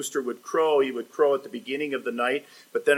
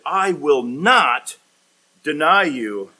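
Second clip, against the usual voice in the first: loud, slow, weighty declaration; emphatic vow; 2 semitones higher, 6 dB louder, slower than usual. Acted. Peter now committed to Jesus, emphatic. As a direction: stretch out the refusal and land it firmly. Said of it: "he says it emphatically".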